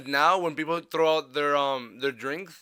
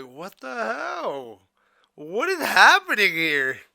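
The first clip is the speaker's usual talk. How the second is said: surprised; 6 semitones higher, 5 dB louder, much slower than usual. Retold or imitated. Retold shock at swarming bees.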